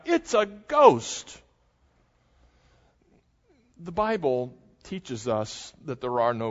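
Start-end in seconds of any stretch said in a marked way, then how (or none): 0.0-1.4 slow, high mock cry of fear; frightened cry. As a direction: cry it out like terrified sailors. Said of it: "They cried out".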